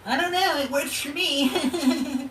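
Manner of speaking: high mocking sweet voice; high mock flattery; fake-nice mimicry. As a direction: mimic a two-faced flatterer. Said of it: "play both sides of the fence".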